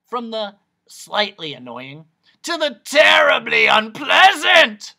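escalating doctor's bellow; building to a shout. Acted. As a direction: swell from moderate to a high, harsh yell. Voice illustrates worsening noise, building to shout.

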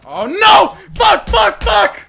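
very high, loud panicked cursing; anguished screaming. Real, not acted. Player losing in a game, anguished screaming. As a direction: scream it, ending in a long wail.

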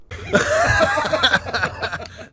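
high squeaky cackle; high mocking laugh. Real, not acted. Mocking laugh after jab.

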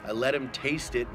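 usual speaking voice

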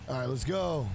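ordinary talk, nothing marked

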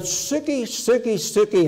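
high ringing calls, the first highest; calling the calves. Retold or imitated. Great uncle calling calves up the field, high ringing call. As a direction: sing it out across the field to the calves. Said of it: "And he shouted".

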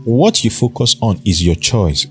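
usual speaking voice

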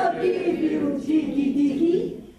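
slow, loud, drawn-out threat; lion's roar. Acted. Storyteller has audience join Lion's threat, slow loud roar. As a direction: roar it slowly, stretching each word.

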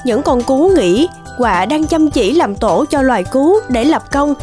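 ordinary delivery

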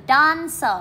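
dancer with weight on DAN; DAN-cer, first syllable stressed. Shown as a demonstration. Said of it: "Các bạn nhấn trọng âm một".

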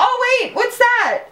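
very high, laughing exclamation; high, playful surprise; excited discovery. Real, not acted. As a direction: squeal as if spotting something. Pointing something out to the children.

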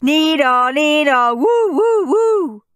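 loud high siren; siren noise. A narrator's reading. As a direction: wail like a toy siren.

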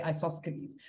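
isosceles as eye-soss-kuh-leez, k sounded; old spelling pronunciation. Shown as a demonstration. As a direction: say isosceles quickly with a hard k. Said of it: "that's how I used to say it".

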